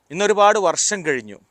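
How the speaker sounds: ordinary talk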